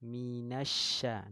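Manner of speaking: slow model of minasya with a spreading sh; drawn-out shin. Shown as a demonstration. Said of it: "demonstrates shin pronunciation".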